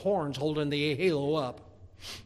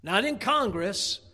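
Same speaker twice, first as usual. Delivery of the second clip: rising louder and higher, list by list; preacher escalating to the congregation; preaching building up; 7 semitones higher, 7 dB louder than usual. Acted.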